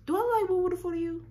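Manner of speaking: indignant. Real, not acted. Indignant rant at absent guy.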